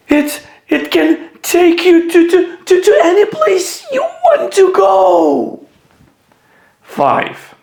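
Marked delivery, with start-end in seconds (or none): 0.1-5.7 stammering high panicked voice; frightened, stammering and nearly crying; scared. Shown as a demonstration. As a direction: stutter it out in fright. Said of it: "read like you are scared".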